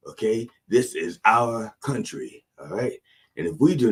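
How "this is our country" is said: ordinary talk, nothing marked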